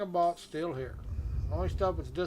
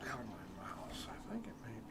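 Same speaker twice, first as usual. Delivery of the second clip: whispering; 4 semitones lower, 13 dB quieter than usual. Real, not acted. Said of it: "I need to slow down my mouse".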